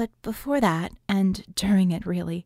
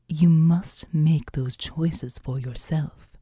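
solemn seraph; 4 semitones lower, slower than usual. Acted. The seraphim answers.

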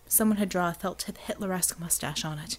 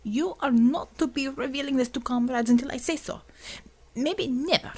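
put-on Russian accent; 5 semitones higher than usual. Xavier orders in Russian accent.

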